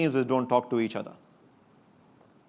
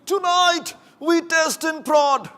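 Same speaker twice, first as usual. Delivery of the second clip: hammed-up movie-hero Scottish accent, much higher and louder, slow; Gerard Butler accent; 5 dB louder, much slower than usual. To the conference crowd, a hammed-up Gerard Butler accent battle cry. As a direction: boom it like a film hero's battle-cry accent before the charge. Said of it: "Gerard Butler accent".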